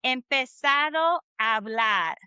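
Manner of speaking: slow Empezado a hablar with the two A's merged; words run together. Shown as a demonstration. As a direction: link Empezado a hablar into one stretch, silent H. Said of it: "meshes together the two A's".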